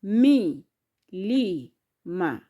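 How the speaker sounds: "Mi, li, ma" separated by short pauses, falling away; syllable by syllable. Shown as a demonstration. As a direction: count out "Mi", "li", "ma". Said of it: "How many are those?".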